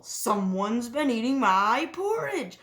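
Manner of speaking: a hint of a papa-bear roar. A narrator's reading. Papa Bear finds porridge eaten, mild roar. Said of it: "roared Papa Bear".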